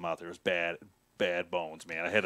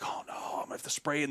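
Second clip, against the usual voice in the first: tense whisper, much softer; hushed dread; 4 semitones higher, 9 dB quieter than usual. Retold or imitated. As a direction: whisper it so the bear won't hear. Bear at his tree stand.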